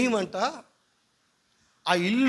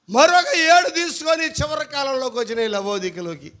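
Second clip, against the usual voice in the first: raised, loud preaching; full-force preaching; 6 semitones higher, 6 dB louder than usual. Acted. Pastor preaches the apostles' miracle, loud and raised. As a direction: proclaim it to the whole hall.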